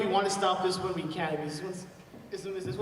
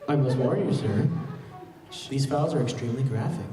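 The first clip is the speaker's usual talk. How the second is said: lower, louder butler-AI voice; Jarvis voice; 7 semitones lower, 6 dB louder than usual. Acted. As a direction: warn him gravely like a polite AI butler. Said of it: "I'll do Jarvis".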